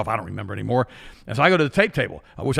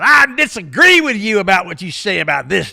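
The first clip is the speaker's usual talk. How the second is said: higher and louder mocking voice; challenging lady; 6 semitones higher, 8 dB louder than usual. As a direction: imitate a heckler picking a fight. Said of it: "this lady comes up to him".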